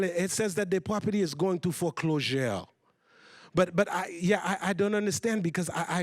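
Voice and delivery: put-on halting French-accented English; mother's strong French accent. Mother phoning about foreclosure papers, strong French accent. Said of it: "my mom has a pretty strong accent".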